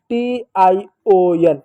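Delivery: letters spelled out one by one, very slowly. Shown as a demonstration. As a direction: spell each letter deliberately. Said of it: "वो है सन". (अक्षर-अक्षर धीरे-धीरे बोलना)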